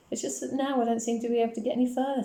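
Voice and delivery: put-on high, weary little girl's voice; plaintive child. A narrator's reading. Daughter confiding about school, plaintive.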